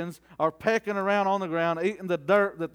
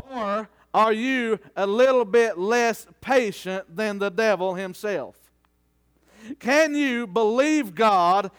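emphatic preaching; 3 semitones higher than usual. Real, not acted. Preacher challenging the congregation to outlast the trial, emphatic preaching. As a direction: drive each phrase home to the congregation.

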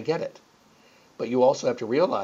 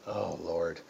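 much softer, lower groan of realization; a hint of an exasperated lady; resigned sigh; 7 semitones lower, 12 dB quieter than usual. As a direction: let it fall out like giving in. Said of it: "And she went".

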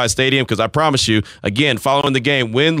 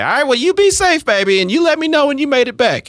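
mom voice; 13 semitones higher than usual. Retold or imitated. Son imitates his worried mom in a high mom voice. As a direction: play the fussing mother calling him baby. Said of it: "her voice don't sound like that".

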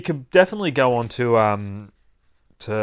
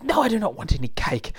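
high-pitched indignant no; mock-irritated character voice; exasperated refusal; 10 semitones higher than usual. Wilma refuses the offered cake.

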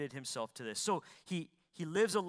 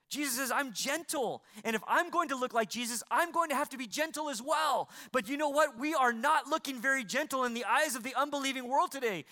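higher, louder, fervent preacher's cry; full-force preaching; 7 semitones higher, 5 dB louder than usual. Real, not acted. Preacher rousing the church about gentleness, full force. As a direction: preach it out to the whole church with fire.

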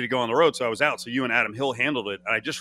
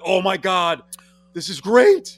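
higher, louder burst of delight; thrilled reaction; 6 semitones higher, 7 dB louder, slower than usual. Retold or imitated. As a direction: exclaim like a delighted fan. Acting out delight at the impression.

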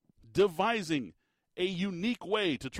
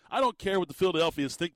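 ordinary delivery

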